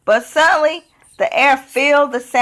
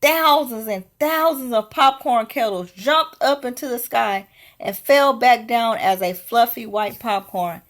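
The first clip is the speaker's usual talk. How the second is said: usual speaking voice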